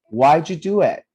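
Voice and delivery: "Why'd you do it" loud, blended as "why-ju do it"; teacher's emphatic model of "Why'd you do it"; "Why'd you" as one "whyju", loud for repeating; pronunciation model. Shown as a demonstration. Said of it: "let's pronounce it together".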